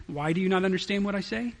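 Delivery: calm, sitting-back question; relaxed, unaccusing delivery; matter-of-fact. Shown as a demonstration. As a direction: ask it evenly, without frustration. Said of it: "almost sort of sitting back saying".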